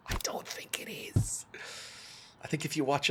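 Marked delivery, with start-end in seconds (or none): 0.1-1.4 hushed, voiceless confession; whispered aside. Pretend. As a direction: whisper the doubt after a laugh. Undercuts own hopeful line.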